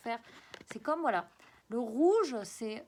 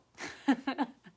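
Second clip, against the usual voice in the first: brief amused laugh at herself, a little louder; short laugh; 4 semitones higher, 8 dB louder than usual. Real, not acted. Teacher laughing at her own slangy remark, short amused laugh. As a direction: laugh off the slip of regional accent.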